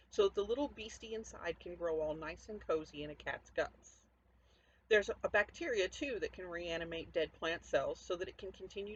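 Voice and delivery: reading her zombie novella aloud, with punch; story narration. A narrator's reading. Author reading her novella to listeners, lively narration.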